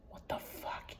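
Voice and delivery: breathless whispered curse; spooked whisper. Real, not acted. Reading the haunted chat alone, a spooked whisper. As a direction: hush it like something might hear.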